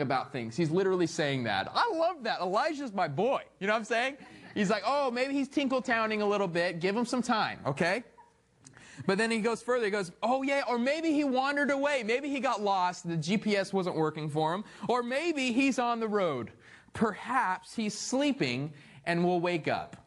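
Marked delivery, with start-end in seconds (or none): 4.8-8.0 high, teasing mock reassurance; playful taunting voice; mocking Elijah. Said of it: "Elijah mocked them". 10.2-20.0 playful sarcastic ribbing; mocking Elijah. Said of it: "Elijah mocked them".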